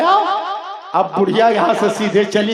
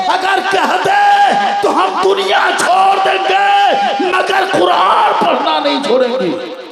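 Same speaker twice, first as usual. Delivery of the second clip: defiant shout; 7 semitones higher than usual. Acted. The daughter-in-law shouts back at her husband, defiant. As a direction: shout your refusal at the husband. Wife defies husband over Quran.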